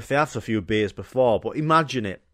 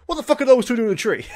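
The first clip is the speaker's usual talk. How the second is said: high, fast shout; coach shouting; 12 semitones higher, 6 dB louder, much faster than usual. Acted. Coach yelling at two in a tree, high fast shout. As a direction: yell it like an outraged coach. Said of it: "He's like".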